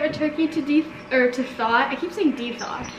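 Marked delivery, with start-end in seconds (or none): none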